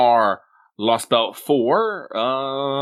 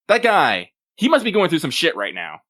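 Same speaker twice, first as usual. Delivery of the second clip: ordinary delivery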